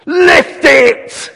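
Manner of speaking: loud, high barking shout at the men; bellowing army corporal. Retold or imitated. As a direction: yell at them like a drill corporal.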